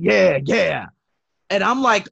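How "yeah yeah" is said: dopey guy voice. Retold or imitated. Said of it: "He just kept saying".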